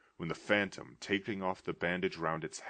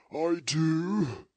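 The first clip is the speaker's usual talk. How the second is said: high, loud spectral moan; the dead partner answering from beyond; ghost's voice; 11 semitones higher, 8 dB louder than usual. Acted. Ghost answering Scrooge in a high wailing voice.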